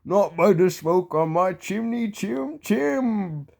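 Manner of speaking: slow, sing-song defiance; mock defiant rhyme. Toby answering the ghost with the rhyme, mock defiant. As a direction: play the little pig in the rhyme.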